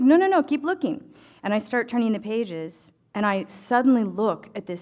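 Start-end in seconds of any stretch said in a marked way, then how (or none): 0.0-1.0 high, loud no no no; imitating the vendor's eager push to keep looking; eager insistence. The vendor urging her through the pattern books, high and loud.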